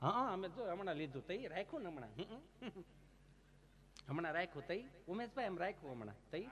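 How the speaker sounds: a soft, mumbling plot owner's reply; mumbled plot buyer. Retold or imitated. New plot owner answering the storyteller, in a soft mumble.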